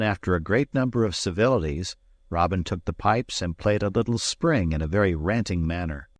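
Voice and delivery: ordinary delivery, nothing marked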